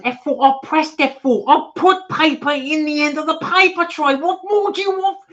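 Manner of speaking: very high, loud mock rant; hammed-up lawyer losing it at a printer; [name]. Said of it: "the lawyer, arguing with the printer".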